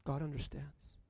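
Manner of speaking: hushed. Real, not acted. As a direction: drop it to a hush for weight. Hushed answer after question.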